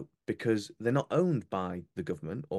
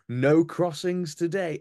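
slow, loud and higher, like a pompous minister; mock proud announcement; 8 semitones higher, 6 dB louder, slower than usual. Mocking the Home Secretary boasting about no crossings. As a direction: parody a smug official bragging a win. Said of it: "December he went".

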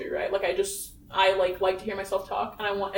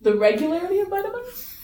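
high incredulous rising question; surprised; 10 semitones higher than usual. Real, not acted. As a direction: echo it back in disbelief. Incredulous echo of poem line.

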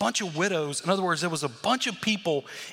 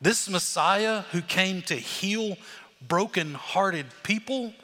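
slow dramatic build; 2 semitones higher, slower than usual. Acted. Preacher repeating the build.

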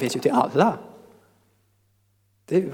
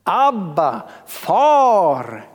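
drawn-out, raised call; crying out; 3 semitones higher, 3 dB louder, much slower than usual. Shown as a demonstration. Preacher crying out to God as a child to father, loud call. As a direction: call it out long and free. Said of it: "Och i honom ropar vi".

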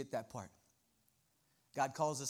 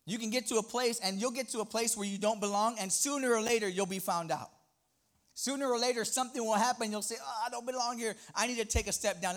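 raised, loud preacher drive; fervent preaching; 7 semitones higher, 5 dB louder than usual. Acted. Pastor urging congregation, full preaching voice. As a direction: preach it with rising force.

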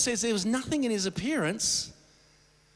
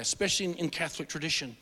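ordinary talk, nothing marked

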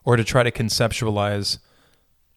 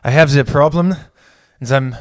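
a lightly done, bad German accent as Hitler; 2 semitones higher, 4 dB louder than usual. Hitler tells the support group his problem, weak German accent. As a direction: mumble a weak German accent, breaking into a laugh. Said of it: "That's a terrible German accent".

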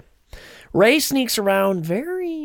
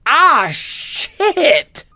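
high, louder yelp of being shot; pained yelp; 8 semitones higher, 6 dB louder than usual. Retold or imitated. Retelling Kylo getting shot, high pained outburst. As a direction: blurt it out like you just got hit. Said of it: "and he goes".

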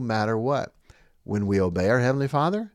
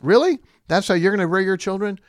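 sharp incredulous rise, higher and louder; 6 semitones higher, 6 dB louder than usual. Real, not acted. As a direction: throw the question back in disbelief. Incredulous rhetorical question.

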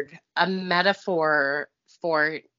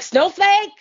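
loud high shout of a name; calling out; 12 semitones higher, 6 dB louder than usual. Retold or imitated. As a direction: yell her name down the hall. Calling out for Snowflake.

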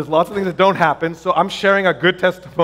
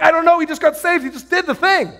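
high-pitched excited protest; amazed explaining; 9 semitones higher than usual. Retold or imitated. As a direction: answer friends in high-voiced disbelief. Retold amazed answer to friends.

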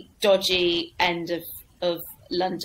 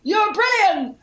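much higher, loud, sloppy exclamation; drunk heckler's praise; 11 semitones higher, 4 dB louder, slower than usual. Retold or imitated. Waking heckler praises the newcomer, high drunken shout. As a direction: blurt it like a drunk who just woke up. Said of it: "like that".

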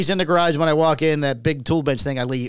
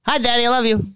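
talking animal; 8 semitones higher than usual. Pretend. Pet voiced by owner.

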